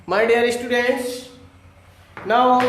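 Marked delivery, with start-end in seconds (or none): none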